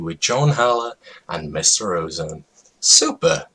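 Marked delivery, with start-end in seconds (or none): 2.8-3.5 silly voice. Acted. Exclamation in a silly voice.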